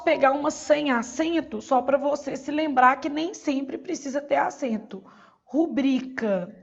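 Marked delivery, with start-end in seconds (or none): 5.4-6.6 "Rubrica" said slowly, stressed on BRI. Shown as a demonstration. Teacher showing an unaccented paroxytone, slow "Rubrica" stressed on BRI. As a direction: draw out "Rubrica", leaning on the middle BRI. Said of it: "a sílaba tônica é o bri".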